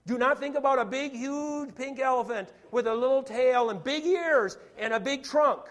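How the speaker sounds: loud, building elephant description; loud, emphatic. Shown as a demonstration. Piles on the elephant image loudly.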